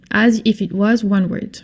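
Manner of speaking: ordinary talk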